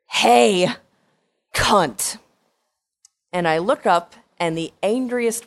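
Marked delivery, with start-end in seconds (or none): 0.1-2.2 loud, hostile shout of an enraged stranger; furious woman yelling at the girl who crashed into her; angry yell. Retold or imitated. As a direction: bark it at the driver who hit you.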